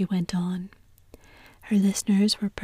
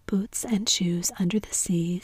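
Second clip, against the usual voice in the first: ordinary delivery, nothing marked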